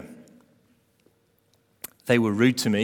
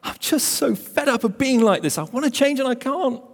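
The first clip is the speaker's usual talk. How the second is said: much higher, whiny moan of a fed-up believer; despairing whine; 13 semitones higher than usual. Retold or imitated. Preacher voicing a believer in despair, high whining moan. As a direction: moan like you've given up on yourself. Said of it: "Or despair".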